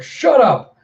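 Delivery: high, loud acted scream; screamed outburst. A narrator's reading. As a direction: scream to silence someone. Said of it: "screams out".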